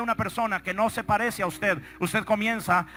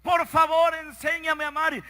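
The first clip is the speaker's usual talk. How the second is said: high, pleading cry to God; crying out in prayer; 5 semitones higher, slower than usual. Retold or imitated. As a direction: plead upward, stretching the words. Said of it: "comenzó a clamar y a decir". (clamor suplicante en oración)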